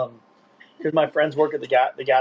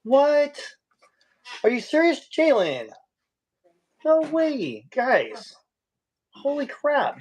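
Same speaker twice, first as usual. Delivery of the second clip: squealing high, disbelieving delight; thrilled gasping surprise; stunned excitement; 11 semitones higher, much slower than usual. Real, not acted. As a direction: shriek with real joyful shock. Reads surprise gift donation live.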